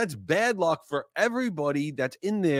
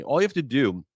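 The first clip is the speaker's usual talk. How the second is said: ordinary talk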